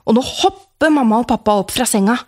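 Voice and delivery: usual speaking voice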